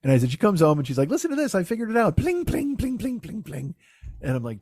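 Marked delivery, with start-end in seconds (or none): 1.1-2.1 high-pitched imitation of a proud beginner; excited imitation. She comes home to show him, imitated high and eager. Imitating Karen showing off her instrument. 2.2-3.8 high sing-song plucking noises; imitated string plucking. She shows off her new instrument, mimicked in high plinks.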